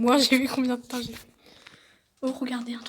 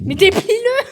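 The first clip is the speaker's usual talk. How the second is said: excited; 9 semitones higher, 15 dB louder than usual. Real, not acted. Urging friend.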